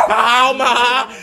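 high, loud stretched cry; yelling back at dog. Real, not acted. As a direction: holler it high to quieten the dog. Dog reacts, speaker yells back.

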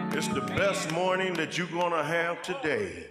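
usual speaking voice

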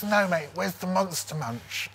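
put-on slow, high, insistent drunk; lightly imitated tipsy demand; insistent drunk customer. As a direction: press the question like a drunken shopper. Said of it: "and she goes".

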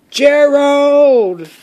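high, drawn-out holler; loud high wake-up call; calling out loudly. A narrator's reading. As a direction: yell the name to wake a sleeper. Pig wakes the sleeping elephant.

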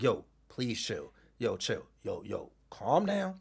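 low, soft pleading; hushed, low, placating appeal; trying to stay calm. Retold or imitated. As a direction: quietly talk someone down. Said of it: "I'm trying my hardest".

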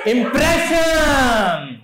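Impression drawn out very slowly and called out high; dramatic reveal. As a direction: stretch the word Impression out like a big reveal. Dramatic one-word reveal to audience.

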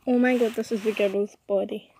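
ordinary delivery

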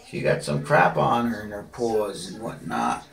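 ordinary delivery

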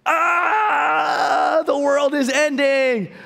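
mock panic. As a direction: cry out as if the sky is falling, for laughs. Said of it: "I was like".

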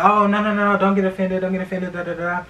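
high-pitched imitation of a backpedalling date; mock placating.

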